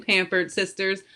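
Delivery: ordinary talk, nothing marked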